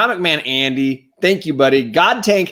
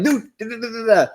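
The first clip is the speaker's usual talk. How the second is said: high, breathless-eager rush of pleading; mock-frantic, high-pitched plea; eager begging; 8 semitones higher than usual. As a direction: beg excitedly like a kid wanting in. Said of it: "I instantly texted him. I'm like".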